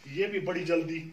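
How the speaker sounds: normal talking voice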